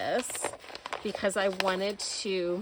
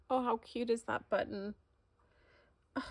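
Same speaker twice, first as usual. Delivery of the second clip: ordinary talk, nothing marked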